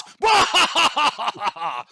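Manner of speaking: high, booming belly laugh; roaring lordly laugh. Acted. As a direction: burst out laughing at your own joke. Lord laughs at his own threat.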